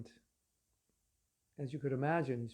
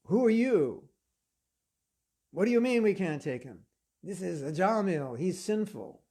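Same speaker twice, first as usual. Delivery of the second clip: loud, high indignant demand; acted affronted objection; indignant challenge; 3 semitones higher, 4 dB louder than usual. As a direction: protest as if your authority is questioned. Death messengers challenge their opponents.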